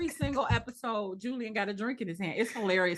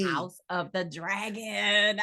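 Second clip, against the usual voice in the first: slow, elongated announcement; drawn-out show title; much slower than usual. Real, not acted. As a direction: stretch out the title slowly, relishing it.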